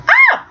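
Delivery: high delighted squeal. Real, not acted.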